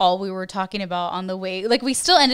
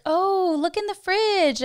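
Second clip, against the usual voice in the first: coy hint; 12 semitones higher, 4 dB louder, slower than usual. Retold or imitated. Hinting at hidden surprise gift.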